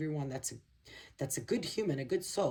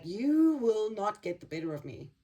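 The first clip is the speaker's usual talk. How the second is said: loud, higher defiant vow; defiant battered warrior; 6 semitones higher, 5 dB louder than usual. Retold or imitated. Battered Nine of Wands figure defying those who attack him, loud and defiant. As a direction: stand your ground and shout it at the attacker. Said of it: "And he's like".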